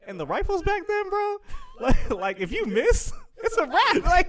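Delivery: high, loud comic alarm breaking into laughs; hammed-up, giggly disbelief; laughing panic. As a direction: squeal through laughter in mock panic. Hammed-up panic over facing a bear.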